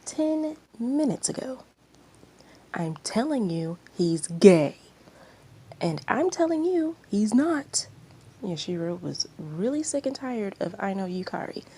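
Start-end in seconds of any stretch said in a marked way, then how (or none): 4.0-4.8 loud, pushy insistence; insistent shout. Acted. Aino insisting to the crowd, loud and pushy. 5.8-7.9 higher and louder, defiant; heated retort. Acted. Yashiro snapping back at Aino, high and loud.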